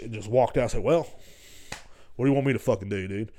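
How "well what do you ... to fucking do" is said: ordinary talk, nothing marked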